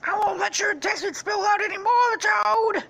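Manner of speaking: very high, loud squeaky wail; horrified toad. Acted. Toad seeing a friend shot, squeaky anguished shout. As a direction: cry out in hammed-up falsetto horror. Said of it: "He's like".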